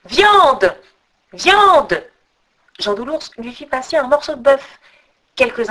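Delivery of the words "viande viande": loud, high, falling calls; eagle's cry. A narrator's reading. Eagle calls to its rider for food, loud swooping cry. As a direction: cry it out twice, long and swooping. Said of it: "l'aigle cria encore".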